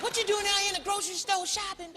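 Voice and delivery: high incredulous question; high amazed fan voice; nosy fan. Retold or imitated.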